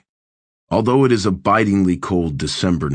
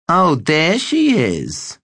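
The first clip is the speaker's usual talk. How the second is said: high, slow and nasal, utterly bored; 10 semitones higher, 3 dB louder, much slower than usual. Acted. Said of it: "says the bored nasal voice".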